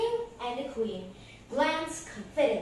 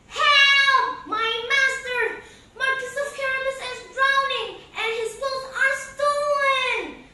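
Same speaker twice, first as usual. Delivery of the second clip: much higher and louder cry for help; screaming for help; 12 semitones higher, 10 dB louder than usual. Acted. Puss screaming to the royal family for help. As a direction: scream for help across the river. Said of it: "Puss screamed out loud".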